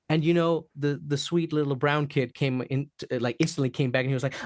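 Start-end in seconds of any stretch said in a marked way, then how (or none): none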